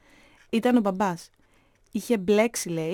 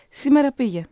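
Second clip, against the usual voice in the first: curt, raised retort, higher and louder; 5 semitones higher, 6 dB louder than usual. A narrator's reading. Mother answering housekeeper about absent husband, curt. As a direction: cut the subject off sharply. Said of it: "είπε κοφτά η μαμά".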